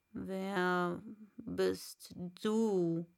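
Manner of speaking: very slow caterpillar; slow, drawn-out caterpillar; Raupe. Acted. Raupe voiced. (sehr langsame Raupe)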